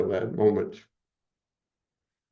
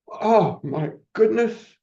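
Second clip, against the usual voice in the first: overwhelmed awe; 10 semitones higher, slower than usual. Real, not acted. Reliving his vision for a friend, high awed exclamation. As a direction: let it burst out in amazement. Overcome while recounting.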